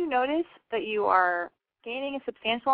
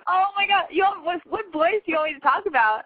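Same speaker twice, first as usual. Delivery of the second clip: high, loud excited squeal; thrilled to hear a friend; 9 semitones higher, 6 dB louder than usual. Real, not acted. Host recognizes caller Mike.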